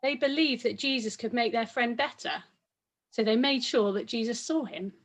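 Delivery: ordinary delivery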